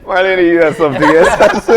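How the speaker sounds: loud laughing tease; laughing loudly. Real, not acted. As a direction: tease her through laughter. Host laughing at the gaffe.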